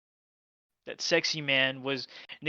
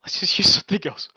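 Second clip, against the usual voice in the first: fast unvoiced pleading whisper; begging whisper; 3 semitones higher, 8 dB louder, faster than usual. Begging friend Noah to switch water, fast whisper. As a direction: hiss a desperate plea. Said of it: "I was like".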